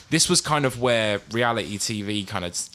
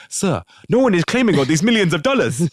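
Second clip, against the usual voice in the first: higher, louder mock West African accent; mock scammer to his boss; 8 semitones higher, 4 dB louder than usual. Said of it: "imagine Robert Azizu going to his boss".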